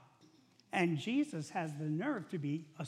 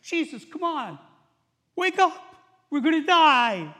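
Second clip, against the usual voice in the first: high, loud yell to wake him; panicked plea; 11 semitones higher, 13 dB louder than usual. Acted. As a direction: cry out in panic to a sleeper. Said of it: "they get up the courage to say".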